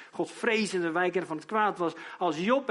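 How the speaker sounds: ordinary talk, nothing marked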